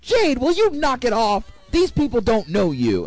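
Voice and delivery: high, loud exasperated mom voice; a mom hissing at her kid to stop; scolding mother. Retold or imitated. Mother scolding her daughter in front of strangers, high and loud.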